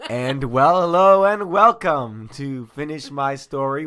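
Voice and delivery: loud, high, stretched-out greeting; big drawn-out announcer opening; showy host welcome. Pretend. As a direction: belt out the show's welcome, drawing out the words. Hammed-up host welcome to show.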